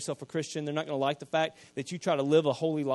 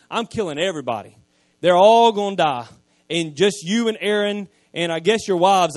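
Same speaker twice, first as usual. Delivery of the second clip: louder preacher's voice driving the story; preaching at full force; 7 dB louder than usual. Acted. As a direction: preach it out to the whole church. Preaching God's and Moses' words.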